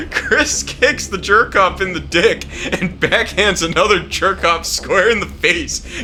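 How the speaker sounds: ordinary talk, nothing marked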